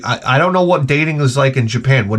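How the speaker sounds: usual speaking voice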